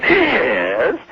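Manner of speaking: long, drawn-out high Yes; the trademark stretched Yes, the heckling clerk; stretched famous yes. Acted. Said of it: "the way I answered you just with the yes".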